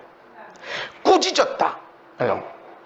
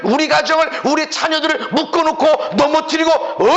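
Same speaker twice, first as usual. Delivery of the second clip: indignant scolding of an enemy; fervent, defiant telling-off; demonstrated rebuke; 3 semitones higher than usual. Shown as a demonstration. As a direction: challenge the intruder with mounting force. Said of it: "꾸짖어야 돼".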